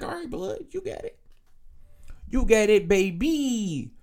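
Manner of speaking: sarcastic high agreement. Retold or imitated. Brushing off his girlfriend's patty melt excuse, sarcastic. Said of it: "I'm like".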